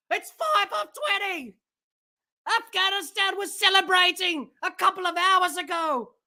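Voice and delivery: normal talking voice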